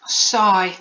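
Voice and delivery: high, loud mock sigh spoken as a word; hammed-up sigh, falling in pitch; Polly sighing. A narrator's reading. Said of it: "sighed Polly with a sigh".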